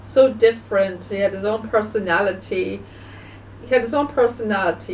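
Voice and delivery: normal talking voice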